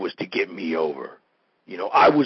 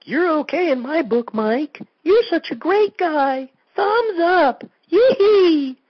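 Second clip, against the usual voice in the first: much higher, slow, cutesy mock-girly voice; mock gushing fan; 4 dB louder, slower than usual. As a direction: squeal it like a sugary fan note. Mocking a fan's smiley email.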